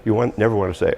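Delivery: normal talking voice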